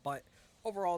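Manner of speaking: hiccups. Real, not acted. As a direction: voice jumping on a hiccup. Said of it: "Sorry, I have the hiccups now".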